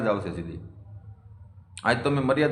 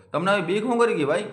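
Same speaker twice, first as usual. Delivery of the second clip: higher, pressing repeat; 6 semitones higher than usual. Real, not acted. To the caller's community, repeating a challenge higher and more insistently. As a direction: press the question harder, higher pitched.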